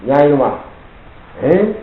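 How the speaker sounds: ordinary delivery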